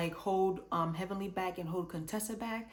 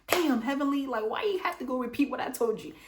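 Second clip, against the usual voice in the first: exasperated outburst; 6 semitones higher, 6 dB louder than usual. Retold or imitated. Quad caught out, exasperated quote.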